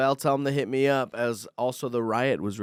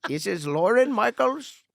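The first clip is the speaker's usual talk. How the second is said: high, slow attempt at an Indian accent; retry the scam caller's Indian accent; 9 semitones higher, 3 dB louder, slower than usual. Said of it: "I can't do an Indian accent".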